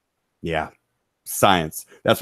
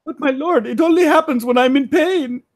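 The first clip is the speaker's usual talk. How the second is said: much higher put-on voice of a suffering servant; high, whiny character complaint; Ramses voice. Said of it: "it makes me laugh every time".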